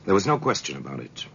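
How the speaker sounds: put-on old radio crime narrator, unhurried; dramatic narration. A narrator's reading. Narrator resumes.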